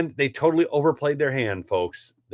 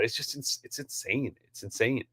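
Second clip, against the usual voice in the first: much softer, disbelieving "it's insane"; dropped to a mutter; 12 dB quieter than usual. Real, not acted. Host stunned by charity CEO pay, much softer muttered disbelief. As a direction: let it fall away under your breath. Exasperated aside after rant.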